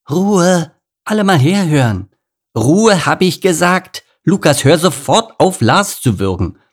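loud, high call for order; exasperated mother. Acted. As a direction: scold noisy children, loud and commanding. Mother calls unruly cubs to order.

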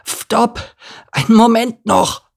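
very high lisping donkey, calling out; Elimar, exhausted croak. A narrator's reading. Exhausted little donkey begs the caravan to wait, high lisping cry. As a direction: squeak a desperate plea to wait. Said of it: "krächzte er völlig erschöpft".

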